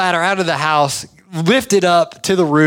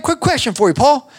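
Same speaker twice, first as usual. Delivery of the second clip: higher, easy-going ask; casual boss; 8 semitones higher than usual. Retold or imitated. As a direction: boss casually asking his worker Paul a favor, voiced higher. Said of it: "he's like".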